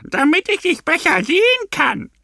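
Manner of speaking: put-on very high squeaky old granny voice; bloated granny answering the girl, very high; granny's answer. A narrator's reading. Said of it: "die Großmutter antwortete".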